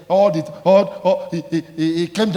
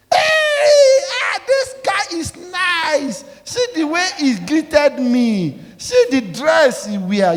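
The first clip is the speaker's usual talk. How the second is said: high, slow, laughing-crying gush; hammed-up smitten admirer; 11 semitones higher, 6 dB louder, much slower than usual. Preacher mimicking a woman gushing over a flashy man. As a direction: swoon like a dazzled admirer.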